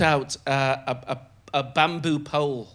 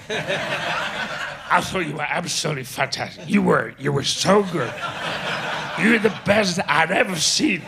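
put-on merged drunken voices; 2 semitones higher than usual. Drinker praising the comedian after the show, merged drunken voice. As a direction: imitate two drinkers blurring into one. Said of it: "the more they drank, the more the voices merged".

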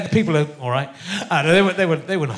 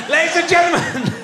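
high, drawn-out announcer shout; hyped live MC call; stage introduction; 11 semitones higher, 3 dB louder, slower than usual. Real, not acted. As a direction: bring the guest on with a big rising yell. Host announces the guest on stage.

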